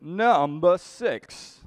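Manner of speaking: high, slow announcer call; number announcement. Pretend. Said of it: "big fan of doing the numbers".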